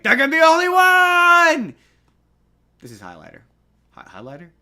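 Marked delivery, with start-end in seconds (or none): 0.0-1.7 drawn-out, high-pitched mock scream; Lindsay Lohan. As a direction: scream it high and loud, stretching the last word. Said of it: "Lindsay Lohan being like".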